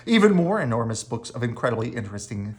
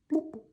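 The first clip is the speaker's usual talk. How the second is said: high soft bloop; bloop sound; 6 dB quieter than usual. Acted. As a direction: pop it like a bubble. Dog sinks.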